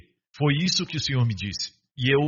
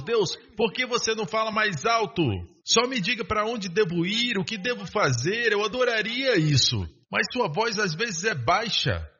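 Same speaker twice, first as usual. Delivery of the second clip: higher-pitched mock complaint to God; imploring, raised pitch; pleading with God; 7 semitones higher than usual. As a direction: plead up high, as a believer asking for more. Pleading with God to speak louder.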